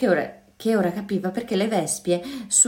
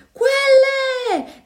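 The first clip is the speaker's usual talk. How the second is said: high, loud, strangled cry of alarm; choked scream; 6 dB louder than usual. A narrator's reading. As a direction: shriek it in terror. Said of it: "gridò con voce strozzata". (grido strozzato)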